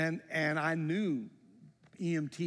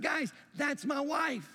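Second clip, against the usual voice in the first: slow, raised, pleading shout; desperate plea; 10 semitones higher, slower than usual. Retold or imitated. Husband pleading with the EMTs to reach his wife, shouting. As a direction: make them hear it's your wife.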